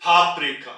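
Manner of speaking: फाफ्रिका said loudly, falling at the end; teacher's model word. Shown as a demonstration. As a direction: say फाफ्रिका clearly for the class. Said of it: "आवाज ऊपर को जाएगी". (फाफ्रिका को ज़ोर से, गिरती लय में)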